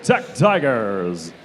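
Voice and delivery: low, slow, drawn-out name reveal; fighting game announcer. Acted. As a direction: stretch the team name for the crowd.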